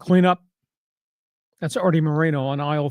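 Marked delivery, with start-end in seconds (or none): 0.0-0.4 lightly imitated store intercom, level and projected; a hint of tannoy call, evenly pitched; store intercom announcement. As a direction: mimic a supermarket announcer calling for cleanup. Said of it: "on the intercom".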